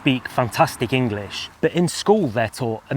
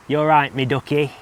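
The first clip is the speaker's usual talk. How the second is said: put-on Mansfield accent; 3 semitones higher than usual. Mansfield local greeting a friend, in a thick Mansfield accent. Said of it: "a Mansfield accent".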